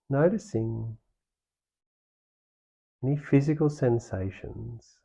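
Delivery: calm meditation guide with long pauses; guided meditation. Real, not acted. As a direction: ease them through it, leaving space between phrases. Leads the meditation practice.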